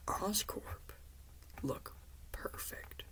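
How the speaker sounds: hushed, threatening whisper; menacing Goblin whisper. Retold or imitated. Goblin threatening Peter, in a menacing whisper. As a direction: breathe it out like a quiet threat. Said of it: "And Goblin then explains".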